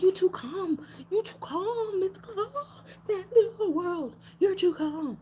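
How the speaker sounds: very high-pitched mock alarm; mimicking panicky people. Others fretting that she is too calm while the world ends, high mock panic. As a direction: squeal it high like someone freaking out.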